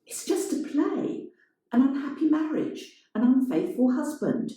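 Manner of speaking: high, defensive woman's voice; Elena's voice. A narrator's reading.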